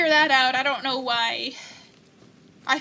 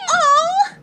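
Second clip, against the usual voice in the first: cooing at pet; 6 dB louder, much slower than usual. Real, not acted. Cooing at cute pet griffin.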